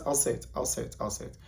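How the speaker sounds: hushed teasing whisper; playful whisper. Acted. Teasing viewers before a controversial remark, whispered. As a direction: lean in and whisper it. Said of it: "innocent, playful way".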